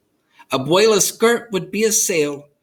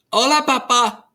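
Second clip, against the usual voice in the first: higher, cheerful call; child calling; 9 semitones higher, 4 dB louder than usual. A narrator's reading. Rosa greeting her father at the windows, higher pitch. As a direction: wave and call to dad.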